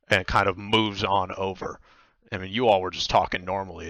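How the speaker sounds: ordinary delivery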